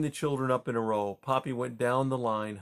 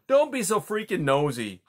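put-on rude kid; 7 semitones higher, 6 dB louder than usual. A narrator's reading. Rude young skunk snaps.

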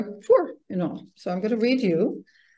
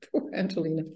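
plain reading voice

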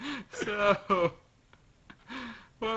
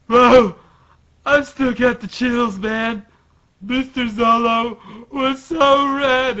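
high, loud awestruck character voice; raised, drawn-out fanboy awe; Bartolomeo; 7 semitones higher, 8 dB louder, slower than usual. Acted. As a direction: gush like an overwhelmed cartoon fan. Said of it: "And Bartolome is like".

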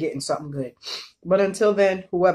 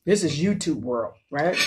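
usual speaking voice

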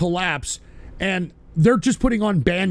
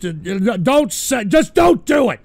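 shouted order; 4 dB louder, slower than usual. Real, not acted. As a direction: bark the order. Yelling at rant senders.

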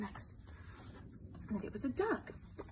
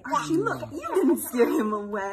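laughing argument; 10 dB louder than usual. Acted.

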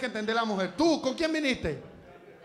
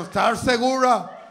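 mock officer; 4 dB louder than usual. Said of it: "Y el de migración".

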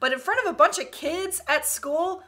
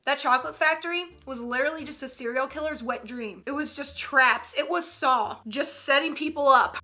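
ordinary talk

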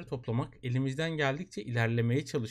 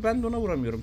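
ordinary talk

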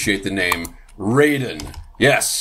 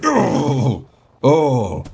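guilty dismay; 3 semitones higher than usual. Real, not acted. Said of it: "I feel terrible".

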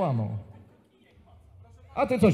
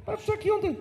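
squeaky put-on voice; 13 semitones higher, 7 dB quieter than usual.

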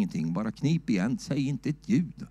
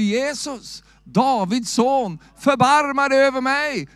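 higher, louder and slow, a desperate plea; loud pleading cry of the blind man; crying out to Jesus; 11 semitones higher, 6 dB louder, slower than usual. Retold or imitated. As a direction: cry it out like a beggar calling for mercy. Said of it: "han ropar ju".

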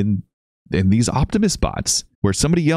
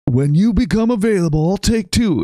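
higher mock yell of the buyer; 12 semitones higher than usual. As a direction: call it out like the guy at the robot. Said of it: "the guy yells it out".

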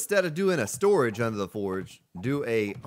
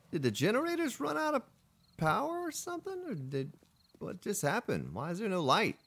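high, softer puzzled questioning; 8 semitones higher, 5 dB quieter than usual. Real, not acted. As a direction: wonder aloud in a high, puzzled voice. Puzzled by sudden loss of light.

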